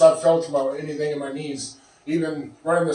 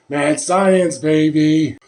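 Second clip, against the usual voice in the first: hyped; 7 dB louder, much slower than usual. Real, not acted. Hyping the experiment.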